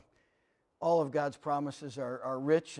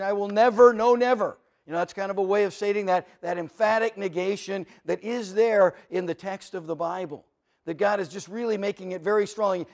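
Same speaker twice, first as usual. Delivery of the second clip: preacher pressing the lost to come to God, preaching at full force; 3 semitones higher, 8 dB louder than usual. Acted. Preaching five nevers loudly.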